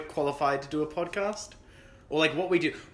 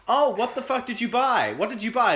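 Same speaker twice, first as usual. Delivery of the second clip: high, louder disbelieving outburst; incredulous friend; 8 semitones higher, 5 dB louder than usual. Retold or imitated. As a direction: react like they bought something absurd. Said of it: "they'll be like".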